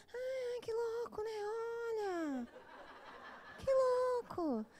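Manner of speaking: high, slow, airy "sonso" voice with a half laugh; playing dumb. Playing dumb so the idiot solves it himself, high and slow. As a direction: act the clueless innocent, drawn out. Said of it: "se você é sonso".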